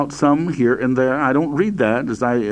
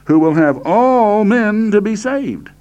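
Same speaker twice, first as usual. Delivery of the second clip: "all" high and drawn out; slow the verse, leaning on "all"; stress on all; 8 semitones higher, 3 dB louder than usual. Shown as a demonstration. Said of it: "I'm emphasizing the word all here".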